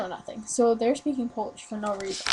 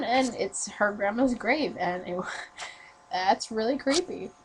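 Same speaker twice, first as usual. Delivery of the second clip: ordinary talk, nothing marked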